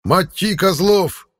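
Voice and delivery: slow, high roaring war cry; battle cry. Acted. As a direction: bellow it out of the dark, slowly. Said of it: "прилетел необычный боевой клич".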